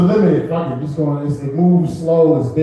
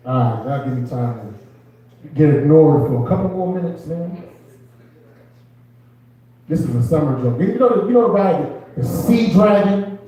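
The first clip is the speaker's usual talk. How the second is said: usual speaking voice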